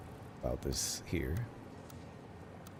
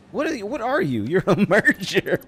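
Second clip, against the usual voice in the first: high, loud disbelieving yelp; shocked outcry; 11 semitones higher, 7 dB louder than usual. Real, not acted. Player shouting at the pieces merging, high and loud. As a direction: cry out at the game in disbelief.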